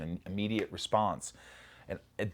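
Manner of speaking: usual speaking voice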